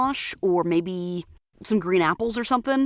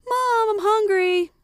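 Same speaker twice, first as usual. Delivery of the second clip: put-on kid voice; 4 dB louder, slower than usual. Kid asking mom for food.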